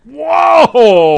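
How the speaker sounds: high, loud amazed whoa; surprised whoa. Acted. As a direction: shout out in amazement. Reacts to prize.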